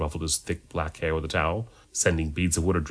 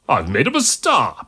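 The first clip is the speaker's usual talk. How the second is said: loud, high, drawn-out protest; indignant self-defence; 7 semitones higher, 8 dB louder than usual. A narrator's reading. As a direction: protest it proudly, stretching the words. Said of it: "protested the monk".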